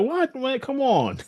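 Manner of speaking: high, outraged mock protest; shocked disbelief. Fan reacting to the prophecy about Egg, shocked and high. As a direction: squeak it out in disbelief. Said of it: "I was like".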